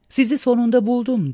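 high, excited call; crying out. A narrator's reading. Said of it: "diye haykırdı".

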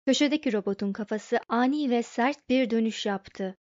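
plain reading voice

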